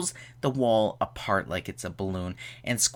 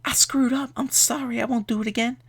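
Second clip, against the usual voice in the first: high whiny mock apology, much higher; mock pleading; 12 semitones higher than usual. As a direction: squeak out a hammed-up sorry like a sheepish husband. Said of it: "he's just like".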